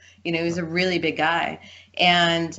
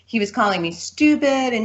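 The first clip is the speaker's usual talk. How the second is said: ordinary talk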